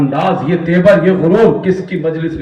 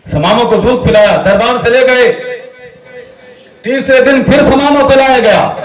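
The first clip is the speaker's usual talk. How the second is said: sermon voice climbing in pitch; rising preaching; 7 semitones higher, 3 dB louder than usual. Acted. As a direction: build the narration higher toward a preacher's cry. (چڑھتی ہوئی آواز میں خطابت)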